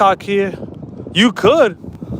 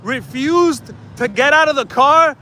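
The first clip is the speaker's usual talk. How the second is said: pitched-up indignant rant; outraged rant; 5 semitones higher than usual. Real, not acted. Complaining to viewers about a cop who stayed in his car, outraged and pitched up. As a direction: vent at the lazy cop.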